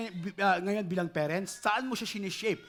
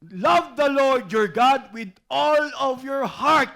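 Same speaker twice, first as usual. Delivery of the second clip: preaching; 4 semitones higher, 9 dB louder, slower than usual. Acted. Preacher proclaims scripture.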